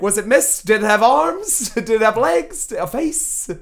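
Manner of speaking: high, loud volley of questions; mock interrogation. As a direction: imitate an over-eager interrogator, each question rising in urgency. Voicing Venkman grilling the old woman.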